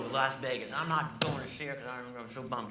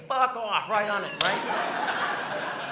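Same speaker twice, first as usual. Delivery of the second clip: louder and higher blunt "fuck off"; mock snarl; 7 semitones higher, 6 dB louder, much slower than usual. Comic voices the grocery divider, mock rude bark. What divider strips should say.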